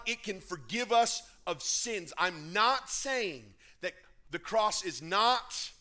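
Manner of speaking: loud, high, emphatic sermon delivery; forceful preaching. Real, not acted. Preacher insists on the power of the cross, forceful preaching. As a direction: drive each phrase home to the congregation.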